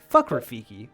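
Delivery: ordinary delivery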